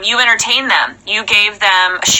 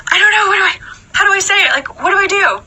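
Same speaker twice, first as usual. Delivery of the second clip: flustered panic; 8 semitones higher, much faster than usual. Retold or imitated. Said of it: "felt that way".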